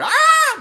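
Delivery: short, very high, loud fake shriek; mock scream. Imagining waking up all red-haired, mock scream. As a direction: let out a hammed-up yelp of horror.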